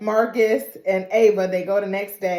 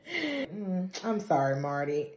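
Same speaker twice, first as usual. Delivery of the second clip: soft low consoling; 3 semitones lower, 8 dB quieter, slower than usual. Retold or imitated. Deborah consoling softly.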